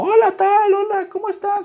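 high, sugary mock greeting; girlfriend's greeting. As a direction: imitate the girlfriend greeting friends sweetly. Girlfriend greets her friends.

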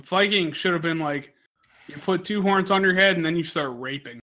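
ordinary delivery

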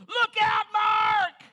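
high, loud warning yell; mom hollering. Retold or imitated. Mother warning her son on the bike, loud high holler. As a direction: yell it to warn him. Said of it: "she hollers".

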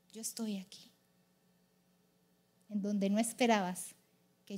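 low, soft, tender reassurance; hushed, intimate divine voice; God comforting gently. Acted.

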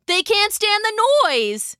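high-pitched excited call; shouted. A narrator's reading. Galen to Lydia amid clanging, shouting. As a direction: call the discovery over a din. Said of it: "he shouted at Lydia".

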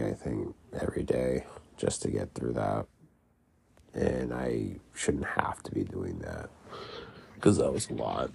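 rough, gravelly sick voice. Real, not acted. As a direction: speak through a gravelly, phlegmy throat. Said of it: "I also apologize for my gravelly voice".

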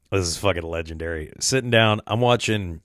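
normal talking voice

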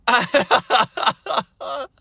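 high-pitched laughing fit. Real, not acted. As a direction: burst out in a squeaky laugh. Laughs at boat line.

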